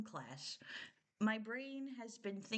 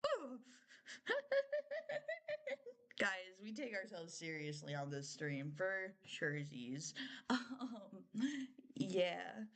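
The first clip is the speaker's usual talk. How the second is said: ordinary delivery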